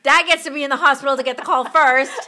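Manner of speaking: high, loud and exasperated; excited exclaim. Retold or imitated. Mother at three in the morning, exclaiming in loud excited disbelief. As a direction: blurt it out in giddy disbelief. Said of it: "I'm like".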